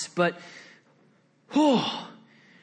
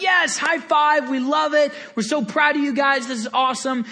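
excited parents; 6 semitones higher than usual. Retold or imitated. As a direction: gush like thrilled parents. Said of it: "they're like".

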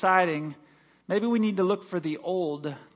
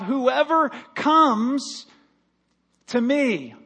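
slow emphatic quote; 6 semitones higher, 5 dB louder, much slower than usual. Acted. Preacher quoting Jesus slowly.

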